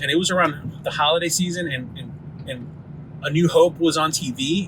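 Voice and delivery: normal talking voice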